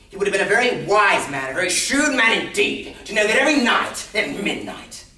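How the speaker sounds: loud, high-pitched declaiming; mocking boast. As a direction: boast at full theatrical voice. Madman boasts nobody could suspect.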